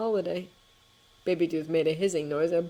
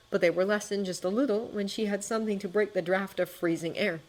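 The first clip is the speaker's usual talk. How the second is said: ordinary delivery, nothing marked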